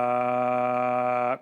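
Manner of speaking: long breath pushed out, ending in a low voiced ah; empty the lungs with a sighing ah; exhale all the air. Shown as a demonstration. Podcast teacher empties his lungs before speaking, a long outward sigh. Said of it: "Si yo hago este ejercicio".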